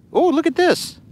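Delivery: very high squeal of discovery; light up at a new find, pitch leaping up; high, delighted exclamation; sudden excitement. Real, not acted. Suddenly spots another find.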